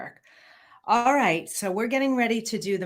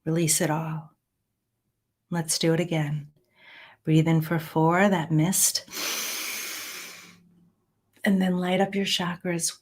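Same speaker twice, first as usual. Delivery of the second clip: guided meditation. Acted. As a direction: guide them calmly through each breath. Leads breathing and counting.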